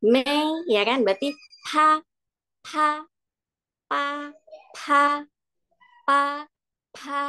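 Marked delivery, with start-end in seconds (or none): 0.0-0.7 "May" high and level; first-tone demo. Shown as a demonstration. Teacher answering which sound is tone one, high "May". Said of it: "Kalau nada satu". 1.7-7.2 "pa" high and level each time; first-tone demo. Shown as a demonstration. Teacher drilling tone one, high level "pa". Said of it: "Kalau nada satu".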